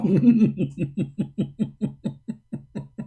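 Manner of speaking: fading chuckle after the joke; amused laugh. Real, not acted. Debater laughing after scoring a point, fading chuckle. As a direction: let the laugh roll out and die.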